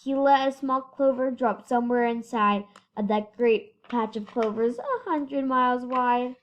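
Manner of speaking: usual speaking voice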